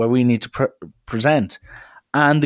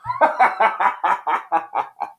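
high, staccato cackle from the back row; imitated lads' laughter; 7 semitones higher than usual. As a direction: mimic lads cracking up at someone. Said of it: "all you can hear is".

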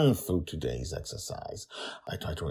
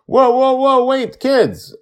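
calling out; 16 dB louder, slower than usual. Acted. Grandpa calling after kids.

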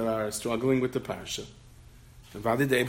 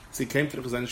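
normal talking voice

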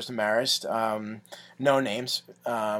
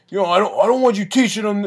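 raised, louder scolding voice; drunk uncle; 6 semitones higher, 6 dB louder than usual. Retold or imitated. Drunk uncle scolding about teaching the kid, raised voice. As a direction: imitate an uncle butting in to tell you off. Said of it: "drunk uncle comes in".

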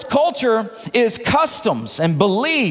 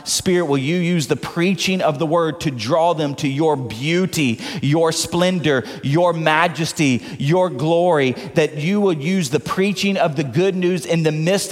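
lower-pitched, even prayer voice; pastoral prayer; 6 semitones lower than usual. Real, not acted. Preacher praying over the congregation before the sermon, lowered prayer voice.

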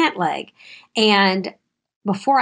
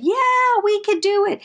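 much higher, bright chorus of six-year-olds; eager kids; 13 semitones higher than usual. Retold or imitated. Classmates agreeing to help clean up, high eager child voice. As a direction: chirp it like keen little children. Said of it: "They all said".

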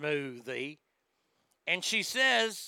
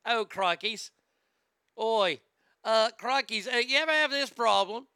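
higher mock-Australian pitchman; accidental Australian accent; 3 semitones higher, 4 dB louder than usual. [name] pitching to infomercial viewers, botched Australian-sounding accent. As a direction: ham up a British accent that slips into Australian. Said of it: "It's all going Australia for some reason".

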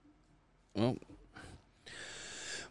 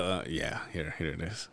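mumbled aside; 10 semitones lower, 13 dB quieter than usual. Retold or imitated. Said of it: "He's like".

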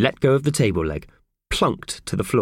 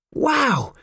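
wow. Acted. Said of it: "said Mickey Thompson".

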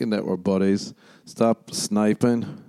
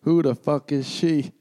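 mock jealous stranger; 6 semitones higher than usual. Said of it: "someone I don't know is like".

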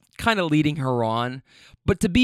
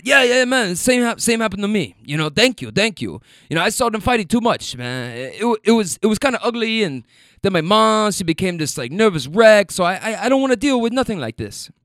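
put-on higher, in a South American accent; 5 semitones higher than usual. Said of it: "so I'm trying to do the accent".

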